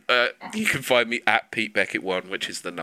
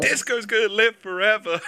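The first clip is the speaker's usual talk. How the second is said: high mock proclamation; shouted joke; 10 semitones higher than usual. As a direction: proclaim it to the rafters. Joking proclamation.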